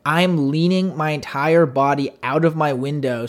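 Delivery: ordinary talk, nothing marked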